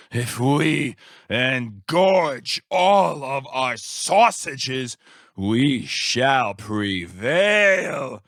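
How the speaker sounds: low, slow, swelling proclamation; rally an imaginary crowd to glory; grandiose villain speech. Acted. Scientist proclaiming his meat plans to the world, grandiose.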